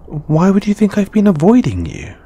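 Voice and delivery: high, loud, flustered question; defensive denial. Acted. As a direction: protest far too much, pitch shooting up. Defensive denial of avoiding flatmate.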